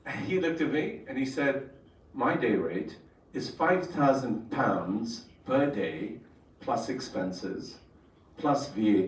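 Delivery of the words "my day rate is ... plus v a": each figure laid down slowly; calm, weighty pronouncement; slow deliberate statement. Retold or imitated. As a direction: let the number sink in. Professor states his fee.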